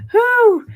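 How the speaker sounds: high, loud whoosh of disbelief; loud exasperated whew. Real, not acted. Host reacts to the Statue of Liberty news, exasperated whew. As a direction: blow it out like you can't believe it.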